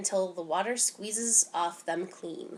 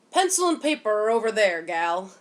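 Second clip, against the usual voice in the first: louder, curt storekeeper talking down; dismissive shopkeeper; 7 dB louder than usual. A narrator's reading. Shopkeeper ordering Mama around, louder and dismissive. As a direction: brush her off like a shopkeeper would.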